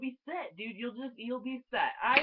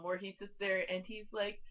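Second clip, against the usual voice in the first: ordinary talk, nothing marked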